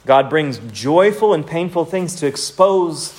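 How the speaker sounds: usual speaking voice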